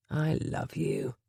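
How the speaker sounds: slow, soft and low; tender declaration. Acted. Gabriel to Penny in his lap, tender and slow. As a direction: say it gently to your wife.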